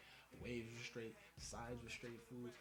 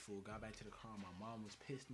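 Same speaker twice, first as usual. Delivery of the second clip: normal talking voice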